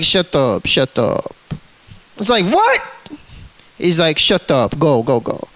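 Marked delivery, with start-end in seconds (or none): 0.0-1.4 low, curt mock cop voice; retold cop's order in a lowered voice; imitated cop. Retelling cop ordering him. 2.5-2.8 high-pitched mock "what?"; retold disbelief, voice shooting up; incredulous retort. Retelling his shocked reply. 4.2-5.5 low, dismissive mock cop; retold cop command, pitched down; imitated cop. Retelling cop ordering him.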